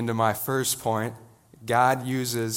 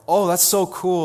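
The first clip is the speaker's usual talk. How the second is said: high, loud mock gush; gushing excitement; 8 semitones higher, 9 dB louder than usual. Classmate hearing he'll be a pastor, high loud gushing. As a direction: imitate a classmate gushing over the news. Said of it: "two responses that go like".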